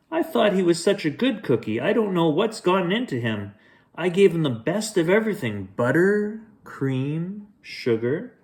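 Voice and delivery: high, fast worried baker voice; fretting baker. Acted. Baker frets to detectives about his runaway cookie, high and fast. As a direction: wring your hands as the fussing baker.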